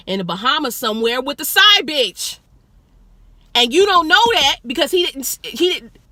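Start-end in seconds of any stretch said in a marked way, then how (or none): 3.5-6.0 louder, higher, indignant; indignant outburst. Real, not acted. Telling the audience about the husband's lie, indignant and loud. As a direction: get heated about what he hid.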